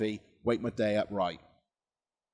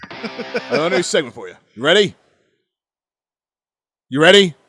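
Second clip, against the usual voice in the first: higher, louder pushy caller; insistent caller; 6 semitones higher, 9 dB louder than usual. Retold or imitated. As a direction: push him, getting louder. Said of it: "This is exactly how it went".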